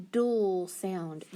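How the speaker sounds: dual said as one drawn-out "dul" syllable; syllable sound. Shown as a demonstration. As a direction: stretch dual into a lone dul. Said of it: "So if I say".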